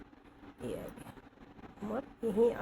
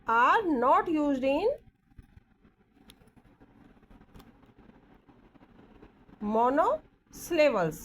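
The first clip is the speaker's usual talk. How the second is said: monosyllables dragged out slowly and louder; slow dictation; 4 semitones higher, 5 dB louder, much slower than usual. Shown as a demonstration. Teacher dictates to the class word by word, slow. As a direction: spell the phrase out at dictation speed. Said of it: "dictating a rule".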